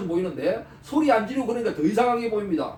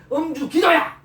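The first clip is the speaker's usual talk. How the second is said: mock yell; 5 semitones higher, 4 dB louder than usual. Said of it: "그렇게 큰 소리 쳤을 거예요".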